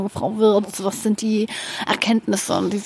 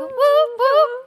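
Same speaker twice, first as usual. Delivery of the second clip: excited cheer. Acted. Birthday cheer sound.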